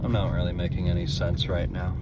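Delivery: low, soft, drained mumble; too tired and needing coffee while driving; exhausted. Real, not acted. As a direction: sound completely worn out. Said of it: "I'm too tired".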